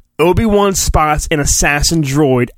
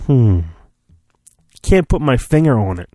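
ordinary talk

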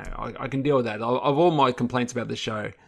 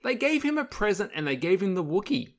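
high-pitched disbelief, words pushed up high; incredulous; 11 semitones higher than usual. Real, not acted. Podcast host ranting to his co-host in high-pitched disbelief. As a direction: sound like you truly can't believe what you saw.

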